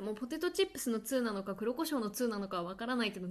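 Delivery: usual speaking voice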